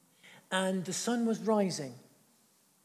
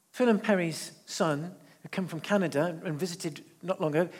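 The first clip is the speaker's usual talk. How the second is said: ordinary talk, nothing marked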